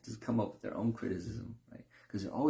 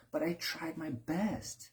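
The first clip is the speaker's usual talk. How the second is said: whiny excuse; 8 semitones higher, 3 dB quieter, slower than usual. Retold or imitated. Mock defensive excuse.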